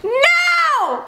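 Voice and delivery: high delighted cry of no; surprised shout. Real, not acted. Vlogger reacts to the prank reveal, high surprised yelp.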